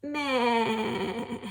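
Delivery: bleating "Meh" like a goat. Shown as a demonstration. Teacher imitating a goat to show the vowel, bleating. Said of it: "the sound that a goat makes".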